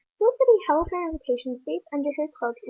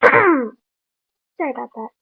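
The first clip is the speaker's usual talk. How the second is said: slow and louder, still clearing the throat; throat-clearing apology; 9 dB louder, much slower than usual. Real, not acted. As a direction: push the words out through a cleared throat.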